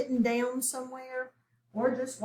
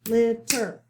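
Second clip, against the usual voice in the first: LITTER in two separate loud beats, LIT, then TER; clapped-out syllables; 5 dB louder than usual. Shown as a demonstration. Teacher has pupils clap it out, LITTER split into two loud syllables. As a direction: break LITTER after LIT, punching each half. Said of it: "so clap it out".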